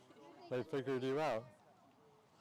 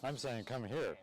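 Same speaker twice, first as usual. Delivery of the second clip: ordinary talk, nothing marked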